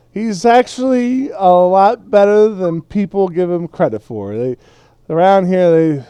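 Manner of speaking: loud bursts, rising high then settling; opinionated tipsy hobbit. Acted. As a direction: defend your friend with tipsy emphasis. Game master plays tipsy Frodo.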